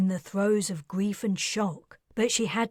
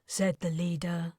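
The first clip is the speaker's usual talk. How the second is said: usual speaking voice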